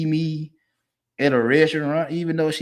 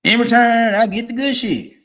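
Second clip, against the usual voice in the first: emphatic punchline; 7 semitones higher, 6 dB louder than usual. Retold or imitated.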